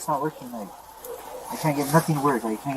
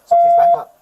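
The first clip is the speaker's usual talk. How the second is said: much louder, high-pitched demand; urgent warning; 13 dB louder than usual. Real, not acted. Armed man ordering an officer to back up, urgent shout. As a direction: warn them back, raised and urgent.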